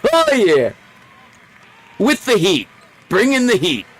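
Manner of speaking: high, loud hammed-up sports announcer yell; announcer hype. Gamer hyping his own game in a booming announcer voice. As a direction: belt it out like an arcade announcer.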